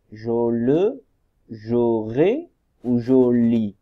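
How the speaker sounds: "Jo le, jo ré, jo li" slow and loud, syllable by syllable; trying syllable combinations. Shown as a demonstration. Teacher tries each ending for pupils, slow loud sounding-out. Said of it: "Donc, on essaye".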